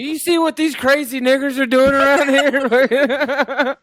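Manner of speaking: high, slow mock-introduction voice; over-friendly stranger. Co-host mocking a first chat with a coworker, over-friendly. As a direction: put on a goofy high greeting.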